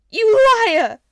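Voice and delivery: very high, very loud accusation; furious cry. A narrator's reading. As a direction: scream it at the king. Peter accuses the king.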